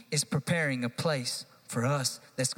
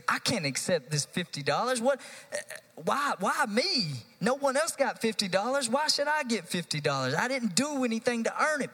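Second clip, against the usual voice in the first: imitated protesting believer; 6 semitones higher than usual. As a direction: play the embarrassed receiver refusing. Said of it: "the temptation is".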